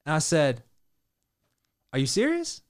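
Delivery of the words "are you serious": excited disbelief. Retold or imitated. Retold excited question to rapper.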